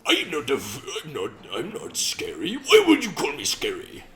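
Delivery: indignant put-on British accent, rising loud and high; hammed-up British accent building to a high, loud finish; mock British accent. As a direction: protest as an offended Brit. Plays the scary Briton objecting.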